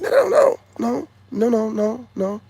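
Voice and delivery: high, loud panicked no no no; comic high panic; frantic refusal. Retold or imitated. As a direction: back away squealing no.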